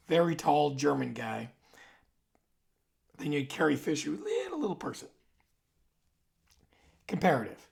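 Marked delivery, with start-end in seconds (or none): 4.1-5.1 a tiny person's pitch; mock high squeak for a small person; squeaky little voice. Small Carrie Fisher, high squeak.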